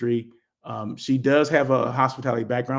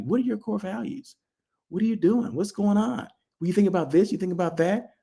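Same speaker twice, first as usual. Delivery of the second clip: higher-pitched mock quizzing; playfully imitating a nosy consultant; imitated probing questions; 6 semitones higher than usual. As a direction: pepper them with questions, pitched up.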